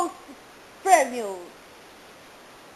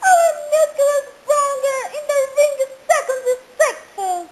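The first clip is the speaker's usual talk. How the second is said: high falsetto woman voice; man doing a woman's voice; 10 semitones higher, 4 dB louder than usual. Acted. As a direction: squeal it like a lady. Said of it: "Só que você faz uma voz de mulher agora". (voz feminina em falsete)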